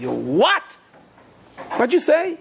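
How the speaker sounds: high-pitched incredulous outburst; shocked disbelief. Acted. Rebbe to student leaving learning, shocked disbelief. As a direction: blurt it out, scandalized.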